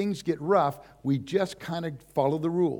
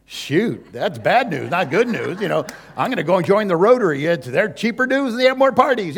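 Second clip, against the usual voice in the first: loud, fast mock grumble, pitched up; mock grumbling churchgoer; 4 semitones higher, 9 dB louder than usual. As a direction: ham up a stingy churchgoer. Mock complaint about church costs.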